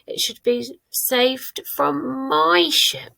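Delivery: slow correction, "my" pitched high; stress on "my". Shown as a demonstration. Teacher correcting third person to first, "my" stressed high. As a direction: lean on "my" as the corrected word. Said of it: "It can't be his".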